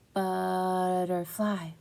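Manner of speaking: drawn out slowly like a rubber band; very slow stretched word. Shown as a demonstration. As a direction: stretch every sound, very slow. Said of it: "stretch out that word and say it really slow".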